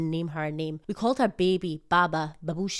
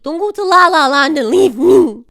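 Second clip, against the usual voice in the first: playful mock wail; 8 semitones higher, 14 dB louder than usual. Mock complaint about husband napping.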